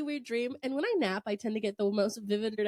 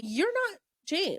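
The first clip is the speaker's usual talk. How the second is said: higher, slow, drawn-out; slow higher "you're not James"; slow accusation; 7 semitones higher, slower than usual. Retold or imitated. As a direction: stretch it out, suspicious. Repeating the accusation.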